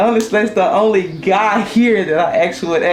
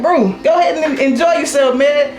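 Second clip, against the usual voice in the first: high, insistent coaxing; pleading urge; 9 semitones higher, 4 dB louder than usual. Retold or imitated. Begging a veteran driver to finally retire, coaxing. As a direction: egg him on to go enjoy life.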